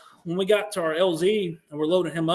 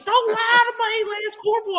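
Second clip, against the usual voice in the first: put-on country Alabama accent; 4 dB louder than usual. Wounded Marine begs his lance corporal, imitated Alabama holler. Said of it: "He's really country".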